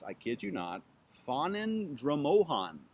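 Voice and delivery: ordinary talk, nothing marked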